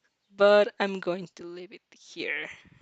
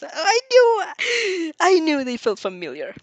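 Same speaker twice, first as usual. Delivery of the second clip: excited; 8 semitones higher, 4 dB louder, slower than usual. Acted. Inasa moved, recognizing words.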